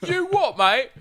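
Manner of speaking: loud, high-pitched mock Cockney; Cockney impression. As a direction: put on a giant comic Cockney accent. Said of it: "talk with a giant Cockney accent".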